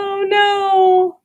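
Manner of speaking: dismayed oh no. Real, not acted. Reacting to animals freaking out.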